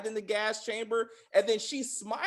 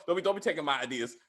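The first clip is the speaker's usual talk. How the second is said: ordinary talk, nothing marked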